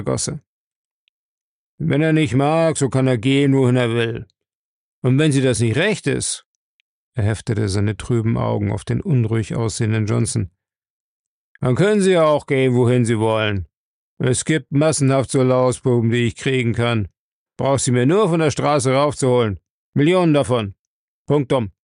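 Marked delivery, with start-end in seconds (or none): 1.8-6.5 leicht rauer, grober Chef; rau und gemein. Acted. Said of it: "Seine Stimme war rau und gemein". 11.6-21.7 leicht rauer, grober Chef; rau und gemein. Acted. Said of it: "Seine Stimme war rau und gemein".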